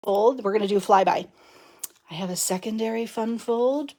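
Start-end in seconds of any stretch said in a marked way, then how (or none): 2.1-4.0 secret whisper, not spoiling. Acted. Showing viewers the hidden project in a whisper. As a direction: hush it like a spoiler.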